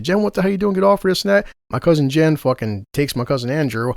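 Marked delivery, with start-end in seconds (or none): 0.0-1.5 higher-pitched mock scream; alarmed, raised-pitch retelling of a yell; retold screaming. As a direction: imitate someone yelling to break up a fight. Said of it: "comes out screaming and shit".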